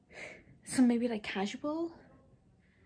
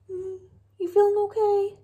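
high-pitched cooing question to a dog; pet talk; 10 semitones higher, 4 dB louder than usual. Real, not acted. Owner checking on her sweetie girl, high pet talk.

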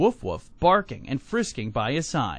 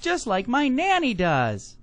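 put-on high, slow, childlike glee; delighted small child; 9 semitones higher, slower than usual. A narrator's reading. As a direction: speak up high as a happy toddler. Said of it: "laughed Bubba".

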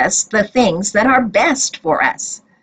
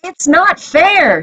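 shouting; 8 semitones higher than usual. A narrator's reading. Widow shouting at the judge, mild shout. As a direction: cry out that it isn't fair. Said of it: "she shouted".

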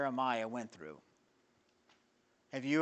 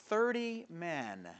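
slow measured; 4 dB quieter, much slower than usual. Retold or imitated. King's command, slow and measured.

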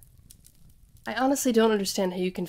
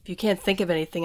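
ordinary talk

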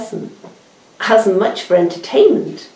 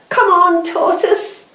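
shouted cheer; 11 semitones higher than usual. A narrator's reading. Animals cheering Tortoise at the line, high shout. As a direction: cheer him on from the finish line. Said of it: "shouted the animals".

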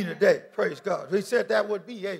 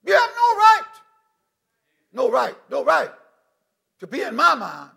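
rebuking Satan; 2 semitones higher, 7 dB louder than usual. Pretend. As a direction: command the devil to leave. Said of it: "you rebuke".

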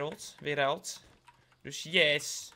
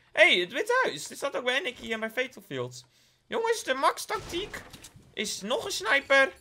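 much higher, loud excited outburst; 10 semitones higher, 6 dB louder than usual. Real, not acted. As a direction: blurt it out thrilled. Excited discovery while gaming.

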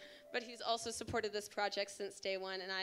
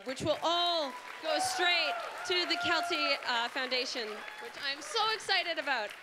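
excited; 5 semitones higher, 5 dB louder than usual. Real, not acted. Thanking sponsors, excited announcement.

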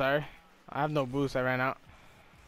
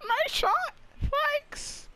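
very high falsetto; squeaky mock praise; slower than usual. Gamer parroting game chat, squeaky mock praise. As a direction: chirp it in a silly tiny voice.

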